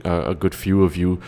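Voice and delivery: ordinary delivery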